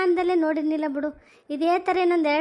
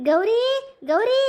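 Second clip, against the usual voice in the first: mother-in-law calling louder across the house; 5 semitones higher, 3 dB louder, much slower than usual. Acted. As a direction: call the name out loud, rising. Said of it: "ಏನು ಕೂಗ್ತಾಳೆ ನಮ್ಮ ಅತ್ತೆ?".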